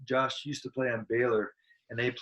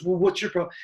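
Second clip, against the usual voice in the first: higher-pitched mock comeback; mildly heated, pitched-up retort; retold angry retort; 7 semitones higher, 4 dB louder, faster than usual. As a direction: imitate a heckler firing back. Heckler's retort in retold argument.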